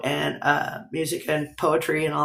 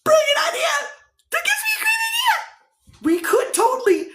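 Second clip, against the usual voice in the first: excited squeal. Real, not acted. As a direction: squeal with a sudden brilliant idea. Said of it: "I just squealed like Rainey".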